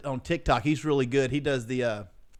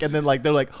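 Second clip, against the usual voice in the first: normal talking voice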